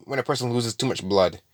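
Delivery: usual speaking voice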